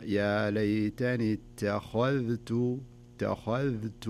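very slow "اتخذت", light ت, heavy خ, distinct ذ; correct recitation. Shown as a demonstration. Teacher modelling the verse, slow "اتخذت" with each letter distinct. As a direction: draw out "اتخذت", only the خ heavy. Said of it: "فتقرأها".

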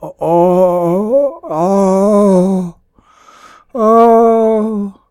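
long, high howls of agony; loud wailing cries, held out; horrible howl. A narrator's reading. Gaunt man overrun by spiders, howling horribly. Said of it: "began to howl".

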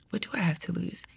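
hushed, voiceless whisper; whispered realisation. Retold or imitated. Thinking to herself after God's question, whispered realisation. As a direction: whisper it as a thought just dawning. Retold dawning realisation in thought.

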